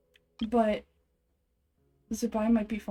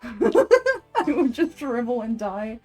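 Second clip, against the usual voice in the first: laughing; 5 dB louder, much slower than usual. Real, not acted. Streamer imagining hitting delete on it all, laughing. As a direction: burst of laughter into slow words.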